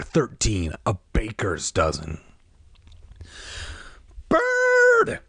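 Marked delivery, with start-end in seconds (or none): 4.3-5.2 high, loud cry of a name. A narrator's reading. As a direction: call out in alarm. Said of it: "Sherry cried".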